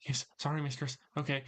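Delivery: small, apologetic minion answering his mistress; meek voice. Acted. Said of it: "a cu-, somewhat meek voice going".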